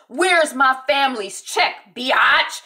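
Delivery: loud, slow threatening demand; menacing demand. Retold or imitated. Hooded man cornering the doctor, loud slow threat.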